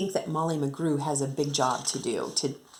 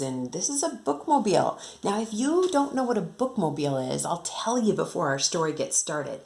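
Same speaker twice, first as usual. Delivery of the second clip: ordinary delivery, nothing marked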